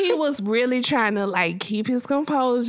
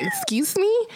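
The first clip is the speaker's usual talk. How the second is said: high, affronted excuse me; mock indignation; 11 semitones higher than usual. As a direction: play the offended guest. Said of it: "the guy was like".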